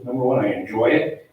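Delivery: usual speaking voice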